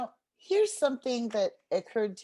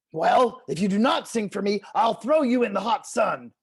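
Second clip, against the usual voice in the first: louder coyote making a new threat; 8 dB louder than usual. Acted. Coyote threatens to throw turtle in the sun, louder. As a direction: push on after a gulp. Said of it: "Coyote gulped".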